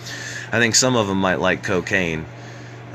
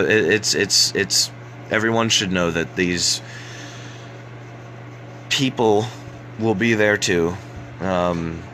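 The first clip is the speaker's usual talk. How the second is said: ordinary delivery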